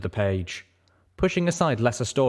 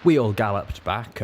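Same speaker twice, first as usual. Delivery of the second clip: ordinary delivery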